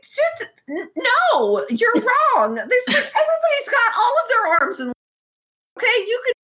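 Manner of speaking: high, near-tears mock protest; panicked Huaisang. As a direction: squeak out frantic denials. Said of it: "And he's like".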